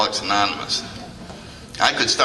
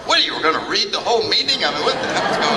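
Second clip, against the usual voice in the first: higher and fast, mock outrage; exasperated old-timer; 8 semitones higher, faster than usual. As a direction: blurt it out like a fed-up guest. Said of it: "before he said".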